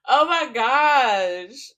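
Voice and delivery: slow, loud oh my gosh; drawn-out exclamation. Real, not acted. Reader overwhelmed by the reading's drama. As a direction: stretch it out, astonished.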